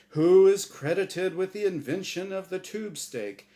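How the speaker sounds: booming, bright host voice; game show host. Acted. The angel Azrael hosting his show for Norm, big host voice. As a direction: sell it like a TV quiz host.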